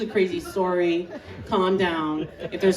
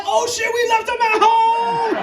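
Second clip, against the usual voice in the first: high drawn-out shout of realization; mock-horrified shout; panicked yell; 13 semitones higher, 6 dB louder, slower than usual. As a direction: yell it, stretching the last word. Sudden realization, acted yell.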